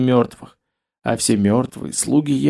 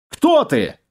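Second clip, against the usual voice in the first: high startled cry; 11 semitones higher than usual. A narrator's reading. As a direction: cry out, caught off guard. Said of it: "вскрикнул Таргитай".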